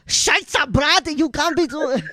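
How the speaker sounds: high, slow mock-angry German accent; mad German. Angry German gamer scolding Brad, mock fury. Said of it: "then when they're mad".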